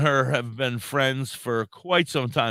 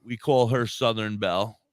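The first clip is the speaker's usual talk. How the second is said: ordinary talk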